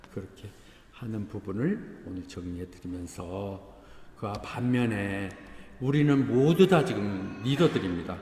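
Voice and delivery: ordinary talk, nothing marked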